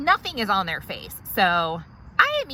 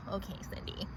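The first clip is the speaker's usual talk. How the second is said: sarcastic mutter; 16 dB quieter, faster than usual. Acted. Muttered reply to the office manager.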